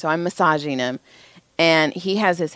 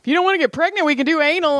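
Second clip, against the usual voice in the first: pitched-up mock recital; high mock reading voice; 10 semitones higher, 3 dB louder, much faster than usual. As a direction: read it high and silly. Reading kid's homework line.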